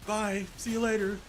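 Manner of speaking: high, singled-out put-on woman's voice; fan's wife. Wife saying goodbye after the kiss, imitated high voice. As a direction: imitate the wife's parting call. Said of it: "And then she's like".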